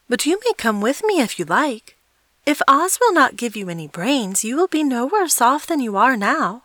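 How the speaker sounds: put-on a child being kind and hopeful; little girl voice. A narrator's reading. Narrator voices Dorothy.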